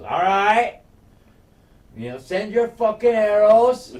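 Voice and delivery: loud, high taunt at the archers; defiant taunt. Retold or imitated. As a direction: shout the dare across the field.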